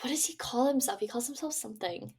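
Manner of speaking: whispered, unvoiced, under the breath. Real, not acted. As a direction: drop to a private whisper. Repeats question, voice drops away.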